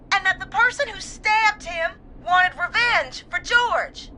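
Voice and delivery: high, crying recollection; tearful. Acted. Caller recalls dying friend's story of revenge, tearful. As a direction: struggle to recall through tears.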